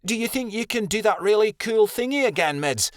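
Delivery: put-on high, lightly childlike asking; innocent young pup. A narrator's reading. Adelaide begging Midnight Star for the star trick, innocent and high. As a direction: ask sweetly, wide-eyed and hopeful. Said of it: "Adelaide asked innocently".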